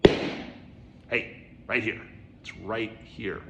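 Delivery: a light breathy "hey", then slow and calm; lightly voiced, unhurried master; Ikkyu's reply. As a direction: point him back to now, simply. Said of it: "E Q's just like".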